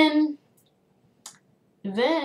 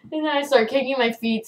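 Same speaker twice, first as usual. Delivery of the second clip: ordinary delivery, nothing marked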